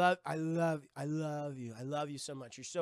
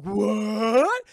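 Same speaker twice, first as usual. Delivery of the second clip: shocked what; 4 semitones higher, 14 dB louder than usual. Retold or imitated. Norrington shocked at proposal.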